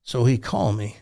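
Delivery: normal talking voice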